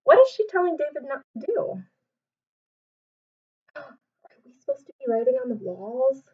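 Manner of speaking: plain reading voice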